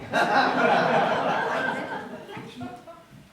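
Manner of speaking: high, loud nervous cackle; high nervous laugh. Acted. Covering up for Irina with a loud nervous laugh. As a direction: laugh too hard to cover the lie.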